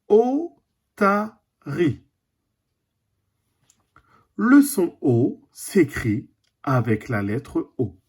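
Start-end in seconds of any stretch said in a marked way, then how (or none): 0.1-2.0 otarie stretched very slowly; slow teaching word. Shown as a demonstration. A teacher showing the class how otarie sounds, very slowly. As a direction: draw otarie out, syllable by syllable. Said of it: "Deuxième dessin".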